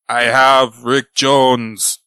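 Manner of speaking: slow, weighty note reading. Retold or imitated. Quoting the Wrecker's note, slow and ominous. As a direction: drag out the villain's note. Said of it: "then the note".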